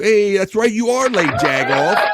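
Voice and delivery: playful shout-back to a late viewer; loud teasing echo. Acted. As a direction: yell it back at the latecomer in mock scolding. Echoing the chat message at the viewer.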